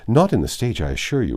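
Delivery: high, bright boasting; quick exultation. A narrator's reading. Miss Steele boasting to Mrs Jennings, exultant. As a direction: crow it out proudly. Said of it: "with quick exultation".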